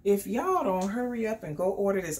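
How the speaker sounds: ordinary talk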